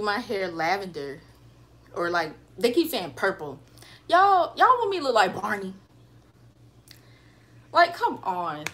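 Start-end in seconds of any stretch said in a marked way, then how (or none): 4.1-8.6 exasperated. Real, not acted. Joking about purple hair.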